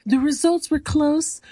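hammed-up announcer building to a shout; mock announcer. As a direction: announce the winner like a show host, building. [name] announces the contest winner.

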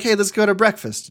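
upbeat invite. Retold or imitated. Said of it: "sometimes he's like".

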